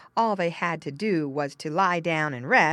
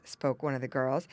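ordinary delivery, nothing marked

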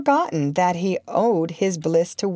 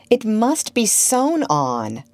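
slow, higher-pitched knowing tone; slightly patronizing; 4 semitones higher, slower than usual. A narrator's reading. Wendy tells Peter what to do, patronizingly. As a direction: explain it as to a child. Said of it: "just a little patronizingly".

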